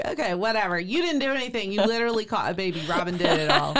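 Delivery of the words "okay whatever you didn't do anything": ordinary talk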